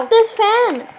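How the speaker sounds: emphatic high-pitched direction; insistent repeat. Real, not acted. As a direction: push it out high and impatient.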